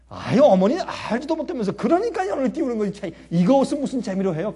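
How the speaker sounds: mock a boy brushing off mom; a touch of brat. Boy talks back to mother.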